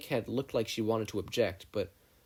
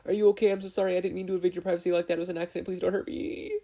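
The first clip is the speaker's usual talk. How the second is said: higher, fast, flustered babbling; panicked apology; 9 semitones higher, 5 dB louder, faster than usual. A narrator's reading. Izuku apologizes to the girl, panicked babble.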